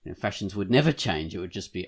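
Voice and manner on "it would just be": ordinary talk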